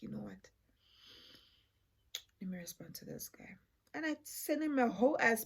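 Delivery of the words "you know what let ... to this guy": hushed. Retold or imitated. Plotting quietly in her room.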